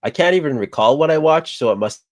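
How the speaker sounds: usual speaking voice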